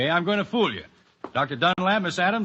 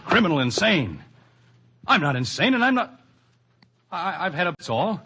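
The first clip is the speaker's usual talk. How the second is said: alarmed protest; 5 semitones higher than usual. Acted. Accused man protests his sanity.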